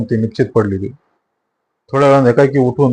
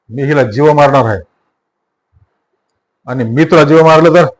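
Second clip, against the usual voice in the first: thundering threat; 2 semitones higher than usual. Acted. Sattu threatening Mathaji, thundering. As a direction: thunder it back at him. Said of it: "sattu कडाडला".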